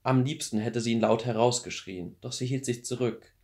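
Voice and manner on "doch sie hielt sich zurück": ordinary delivery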